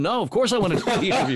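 hammed-up protest, voice jumping up; mock exasperated insistence. To the manager on the phone, insisting he wants the interview, mock exasperated.

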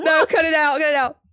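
giggly loud plea to edit it out; laughing protest. Real, not acted. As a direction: beg him to cut it while cracking up. Laughing at his name slip.

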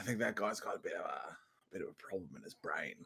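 unvoiced whisper, behind his back. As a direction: lean in and whisper it. Whispered aside about pigeon.

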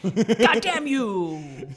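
loud, drawn-out mock curse; long, loud, joking cry of betrayal; mock outrage. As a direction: curse them out in playful outrage.

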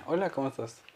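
out of character. Acted. Host guessing how the comedian greets offstage, out of character. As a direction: greet softly in an offstage, everyday voice. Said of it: "cómo habla cuando no está haciendo su personaje".

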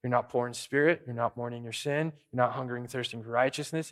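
normal talking voice

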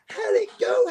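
high, loud eager wife calling out. Retold or imitated. Wife greets him at door.

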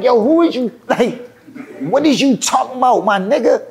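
high-pitched retold indignation; getting mad. Rapper confronting [name] in the studio, mock-angry and high. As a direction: act out an affronted challenge. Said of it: "now I'm getting mad".

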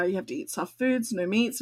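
usual speaking voice

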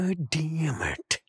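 slow, soft drawn-out swearing; hushed exclamation. Acted. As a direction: stretch it out under your breath.

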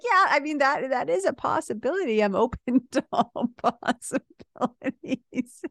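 amused, slowing with a laugh; wife laughing as she concedes the point; laughing concession. Retold or imitated. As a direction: concede it, chuckling. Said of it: "And I'm like".

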